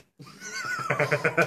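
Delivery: a high squeal of laughter at the absurd line. Real, not acted. Reader cracking up at her own fanfic, high squealing laugh. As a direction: let a shrill laugh burst out.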